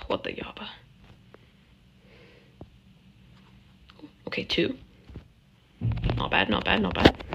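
tense unvoiced whisper. Real, not acted. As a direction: whisper it to yourself.

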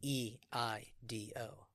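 slow, letter by letter spelling. Real, not acted. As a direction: spell each letter out slowly. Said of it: "Can you spell it for us?".

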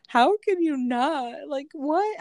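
high-pitched, drawn-out incredulity; mock baffled wail; acted disbelief. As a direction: protest in high, stretched-out bafflement at someone so unmoved. Retells asking dry-eyed husband back.

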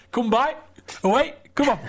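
high coaxing call to a dog. Retold or imitated. Stoke fans coaxing a woman's dog, high and playful. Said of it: "saying stuff to the dog like".

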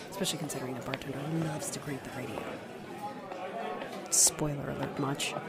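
fast, soft grumbled aside; quick mutter. Acted. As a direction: rattle it off under your breath. Continues grumbling to herself.

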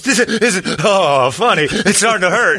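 high, loud voice broken by mock moans; imitated moaning woman. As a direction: protest helplessly through the pleasure, high-pitched. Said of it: "And she goes".

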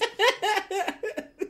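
very high burst of laughter. Real, not acted. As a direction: squeal with laughter. Laughing at own story.

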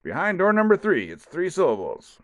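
game show host. Pretend. Game show announcer bit.

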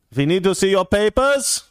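high-pitched mock German officer demanding papers; German accent. Host mocking vaccine passports, mock German accent. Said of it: "I can do that accent because I'm German".

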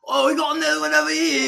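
high, loud put-on cop voice; goofy officer at a crime scene; mock cop voice.

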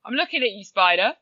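a mock-bold talk to a spider; mock challenge to a spider. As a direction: stare the spider down, half joking. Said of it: "If we actually then go".

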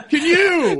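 high giggly teasing; laughing. Real, not acted. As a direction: squeal it while cracking up.

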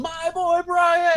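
high, loud, slow yell of the name; retold fan yell. Cheering the rookie's touchdown, loud high yell. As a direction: belt it out like a fan on the couch. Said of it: "screaming and yelling and yelling".

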